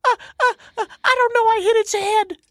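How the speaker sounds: very high panicked squeal; frantic Jamie voice. Retold or imitated. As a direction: shriek it out in a panic. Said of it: "in a frantic Jamie voice".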